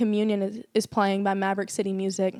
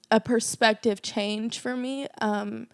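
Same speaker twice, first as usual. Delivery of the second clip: ordinary delivery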